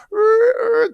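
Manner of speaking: very high, loud tire-screech noise; imitated car screech. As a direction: mimic screeching tires with the voice. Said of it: "cars are screeching".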